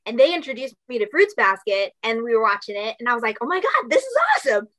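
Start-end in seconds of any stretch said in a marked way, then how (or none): 3.4-4.7 high, fast fangirl squeal; excited gush. Retold or imitated. Recalling first watching the show, excited, high and fast. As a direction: gush like a thrilled teen fan. Gushing over watching Fruits Basket.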